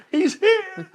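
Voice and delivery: high, loud and laughing through it; laughing repeat. Retold or imitated. Retelling the neighbors' call, laughing hard. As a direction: laugh as you echo the call.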